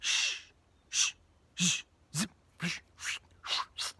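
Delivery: repeated shushing hiss; hushing. Retold or imitated. Caleb quiets the people before Moses, shushing. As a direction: shush the crowd to silence. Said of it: "He's like".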